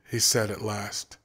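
plain reading voice

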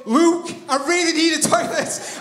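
pleading friend. Retold or imitated. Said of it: "The guy next to me is going".

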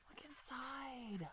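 slow awed whisper; gasp and whisper. Real, not acted. Opening the journal, gasp and slow whisper. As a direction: gasp then whisper it slowly.

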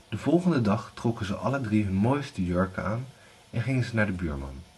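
ordinary delivery, nothing marked

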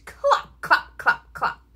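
short, loud clops; horse-hoof clops. A narrator's reading. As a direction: clop like horse feet. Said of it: "He can sound like horse feet".

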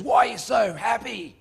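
high put-on bloke voice; questioning pub bloke. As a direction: quiz him like a baffled bar regular. Acts out pub bloke questioning him.

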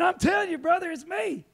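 high and loud, insisting it's you; thrilled pleading shout to brother; excited shout. Retold or imitated. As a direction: shout it out, begging to be picked. Eagerly claiming the free tires.

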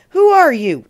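loud, high demand. A narrator's reading. Oscar challenges the voice above him, loud and demanding. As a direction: demand an answer from a stranger. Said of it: "demands Oscar".

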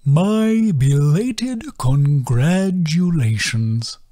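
very slow, wildly rising and falling; slow arpeggiating turtle sigh; sighing sing-song. A narrator's reading. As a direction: sigh it in a lilting sweep. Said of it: "his voice arpeggiating wildly".

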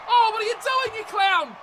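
high, loud outraged yell; exasperated shout. Real, not acted. Gamer yelling at a blundering game player, exasperated. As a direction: berate a teammate for a blunder.

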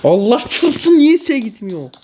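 high, drawn-out frustrated wail; exasperated outburst. Real, not acted. Frustrated that game control fails.

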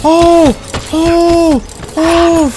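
very high, loud no; panicked scream. Real, not acted. Player screaming as the monster gets close, panicked.

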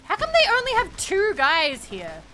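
baffled, indignant exclamation; incredulous. Real, not acted. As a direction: protest in rising outrage at the game. Indignant at what the game shows.

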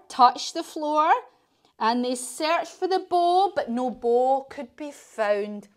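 ordinary delivery, nothing marked

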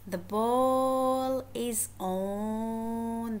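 slow, with "on" held very long; stress on ON. Shown as a demonstration. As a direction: stretch out "on" at the end. Teacher stretches the preposition being taught.

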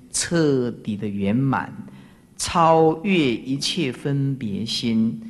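slow, drawn-out formal listing; stately, measured teaching recitation; solemn recitation. Shown as a demonstration. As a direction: recite each quality slowly, syllable by syllable. Recites the qualities of Buddha's heart.

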